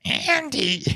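very high cartoon toy-mom call through a laugh; Mrs. Potato Head voice. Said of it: "Missus Potato Head's voice".

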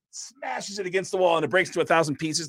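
ordinary talk, nothing marked

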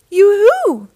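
loud, high, singsong holler; calling out. A narrator's reading. Ranger calling down from the mountain, loud high call. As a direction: hail someone from a distance. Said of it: "called a voice".

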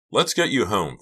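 ordinary delivery, nothing marked

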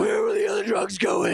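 hammed-up Batman growl. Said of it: "yours is way better".

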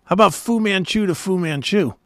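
ordinary talk, nothing marked

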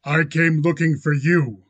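loud, hard-edged challenge; metallic ring. A narrator's reading. Rancher confronts the man he sought, metallic ring. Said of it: "His voice had a metallic ring".

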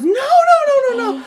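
put-on high, loud, distraught child's wail; panicked crying no. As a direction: cry out in sudden panic. Son breaks down, crying.

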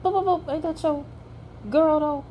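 high sing-song boop boop dismissal; mocking high boops, then ain't that your girl; sassy shutdown. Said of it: "I said".

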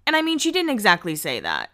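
normal talking voice

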